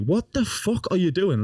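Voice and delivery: high, drawn-out mock disbelief; incredulous outburst. Grandson confronting nana who is wrecking the room, incredulous outburst. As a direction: act out appalled confrontation without shouting.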